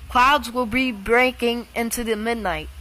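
weather alarm voice. Acted. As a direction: announce the storm like an automated alert. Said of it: "the voice just sounds like me".

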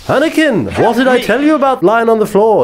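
mock Obi-Wan nagging Anakin in battle; urgent Obi-Wan. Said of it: "I'm Obi-Wan".

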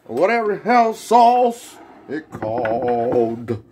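high, loud, slow showman pitch; hammed-up sauce pitch. Real, not acted. Cook pitching sauces to viewers, high and loud. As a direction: sell the sauces like an announcer.

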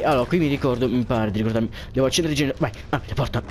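ordinary talk

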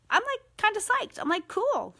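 high, bright excited lift; upbeat glee; psyched. Real, not acted. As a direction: sound genuinely psyched about it. Excited about husband's free time.

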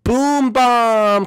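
big loud reveal; loud, high winner call; excited announcement. Real, not acted.

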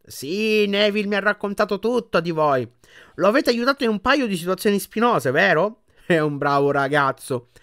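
a stately grandmother paying a compliment; formidable old witch. A narrator's reading. Grandmother addressing friends. (anziana strega solenne, voce acuta)

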